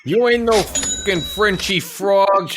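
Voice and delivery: mock New York trash talk; New York accent. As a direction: square up to the rival in a hammed-up New York accent. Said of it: "The raccoon has a New York accent".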